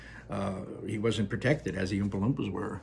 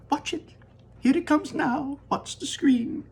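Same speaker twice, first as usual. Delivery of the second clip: Wonka crying out; 3 dB louder than usual. Acted. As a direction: sing it out with delight. Wonka high voice.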